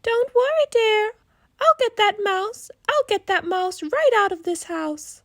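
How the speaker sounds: rally the house like a mom with a broom; determined mom. Acted. Mother with broom.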